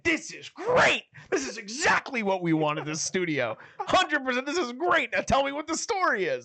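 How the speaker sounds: high, emphatic mimicked enthusiasm; retold delight. Retelling the boss loving the trailer, hammed-up delight. Voices a boss's delighted approval.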